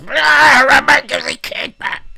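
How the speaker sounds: screaming mum, very high and loud; mock furious shout. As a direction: yell it like an enraged mother at the zoo. Mumsnet mum shouting at Harambe.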